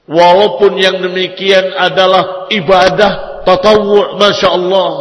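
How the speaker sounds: ordinary delivery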